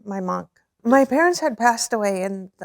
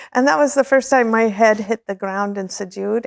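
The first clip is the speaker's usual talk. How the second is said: normal talking voice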